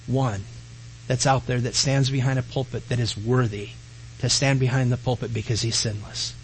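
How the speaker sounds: low, soft confiding admission; a hushed, humble pastor's aside; quiet confession. Real, not acted.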